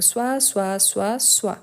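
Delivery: "soit" said as swa, the same each time; identical pronunciation. Shown as a demonstration. Teacher showing learners the forms sound alike, identical "soit" each time. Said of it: "la prononciation, elle est la même".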